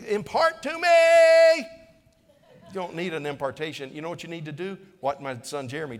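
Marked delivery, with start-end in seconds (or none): 0.1-1.6 high, drawn-out mock wail; loud pleading cry, last word stretched; mock plea. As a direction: belt it out as an exaggerated, needy plea. Mimics people begging for impartation.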